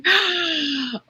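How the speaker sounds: high, sharp mock gasp; big astonished intake of breath; starstruck gasp. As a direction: re-enact gasping at a celebrity. Re-enacts starstruck reaction to celebrity.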